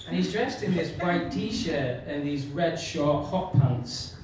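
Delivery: usual speaking voice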